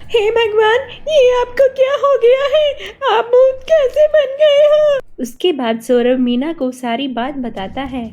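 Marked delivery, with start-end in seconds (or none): 0.0-5.0 high, loud, distressed wife's voice; shocked wife. Acted. Wife learns her husband became a ghost, high shocked wail. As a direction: exclaim in high-pitched dismay, dragging the last question.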